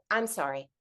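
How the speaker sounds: low, soft, simple apology; tender motherly validation, voice dropped; sincere apology. Retold or imitated. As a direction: apologize quietly and gently. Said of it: "This time she just was like".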